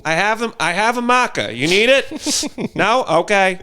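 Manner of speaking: high, bright-toned nurse voice, fast; chirpy nurse. Retold or imitated. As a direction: play an overly cheerful nurse offering a marker. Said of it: "I hear one of the nurses talking".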